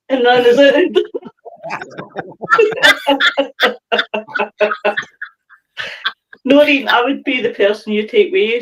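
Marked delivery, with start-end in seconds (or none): 0.8-5.2 high laughing. Real, not acted. Laughing at own joke.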